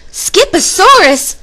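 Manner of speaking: high, drawn-out exclamation; mama's high, loud outburst; loud declaration. A narrator's reading. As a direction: declare it loudly, stretching the word. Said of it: "declared Mama Junebug Jones".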